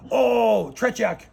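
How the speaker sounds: a mild mock Russian nodding in approval; imitating the calmed officer, fading to normal; approving Russian officer. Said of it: "he goes".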